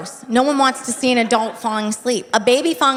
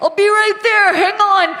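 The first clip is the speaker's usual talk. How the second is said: high, raised call from another room; called from far off; 10 semitones higher, 4 dB louder than usual. Retold or imitated. Husband calling from two rooms away, raised high voice. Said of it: "I hear from two rooms away".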